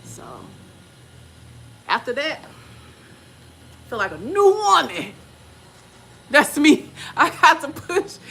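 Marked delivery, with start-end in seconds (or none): 2.4-8.2 high laughing happy talk. Real, not acted. Telling listeners about her birth, high giddy laughter.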